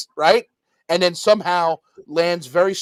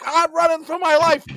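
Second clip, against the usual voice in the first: mocking panicked hero; 12 semitones higher than usual. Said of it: "He's just running with it like".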